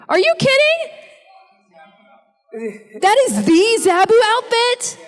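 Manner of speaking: real high-pitched disbelief, thrilled; astonished excitement. Real, not acted. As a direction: gasp it out high, starstruck by the find. Spots fan in Zabu outfit.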